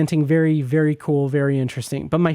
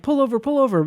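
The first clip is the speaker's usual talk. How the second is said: higher, urgent pull over; urgent plea; 9 semitones higher, faster than usual. Retold or imitated. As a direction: imitate him begging her to stop. Retelling his panicked demand.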